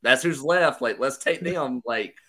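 usual speaking voice

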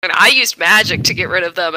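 ordinary talk

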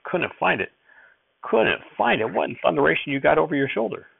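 an irritated grandfather's retort; snapping loud. Acted. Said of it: "he snapped good and loud".

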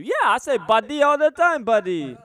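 put-on high, loud shop-owner accent; mock Arabic shop owner. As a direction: play the cheerful shop owner. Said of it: "they would tell you".

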